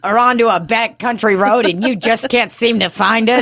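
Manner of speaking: high-pitched ad pitch; hammed-up, high advert questioning; mock commercial. Mock law firm commercial.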